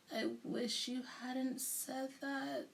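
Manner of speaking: groaned. A narrator's reading. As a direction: drag it out, dreading what comes. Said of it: "Camilla groaned".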